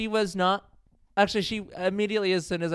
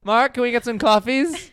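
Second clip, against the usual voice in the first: higher, louder request thrown across; calling out; 6 semitones higher, 6 dB louder than usual. Real, not acted. Host asking Mark for coffees, calling out. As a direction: call over to someone for a favour.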